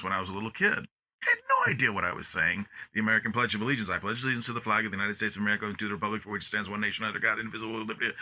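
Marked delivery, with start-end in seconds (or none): 3.9-8.1 put-on rattled off fast, like a kid by rote; rote recitation. Recalling how a kindergartner chanted the Pledge, fast and rote. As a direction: speed through it without understanding. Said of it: "just kind of a set of barking, in sequence utterances".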